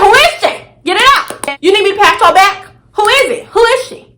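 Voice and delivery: retold angry confrontation; demanding. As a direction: push him, pitch up and demanding. Confronting cheating boyfriend.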